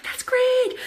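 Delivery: gushing at a new partner; chirpy enthusiasm. Retold or imitated. Answering an eager new partner, high and chirpy. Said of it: "And I'm like".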